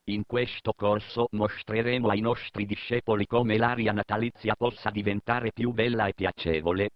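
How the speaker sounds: ordinary talk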